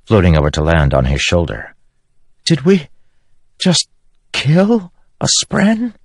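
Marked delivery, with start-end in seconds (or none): 2.4-5.9 high, slow stunned question; horrified spren. Acted. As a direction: disbelief after the kill. Syl horrified.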